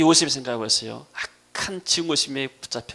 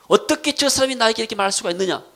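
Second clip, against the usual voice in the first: high, loud protest at being wronged; indignant disbelief; 8 semitones higher, 5 dB louder than usual. Acted. Preacher voices someone hurt by another's words, indignant and loud. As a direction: demand an explanation indignantly. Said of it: "믿음이 없게 되면은".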